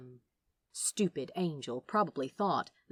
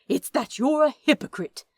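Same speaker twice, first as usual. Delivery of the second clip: accusing; 7 semitones higher, 8 dB louder than usual. Acted. Aziraphale accuses Crowley.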